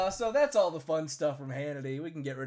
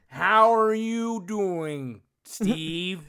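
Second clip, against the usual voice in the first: put-on slowed-down Minnesota accent; 3 semitones higher, 7 dB louder, much slower than usual. Host mimics Mike Lindell's Minnesota accent to his cohost, slow and drawn out. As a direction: stretch every word like a folksy Minnesotan. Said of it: "[name] is just slowed down Mike Lindell".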